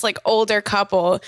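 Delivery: normal talking voice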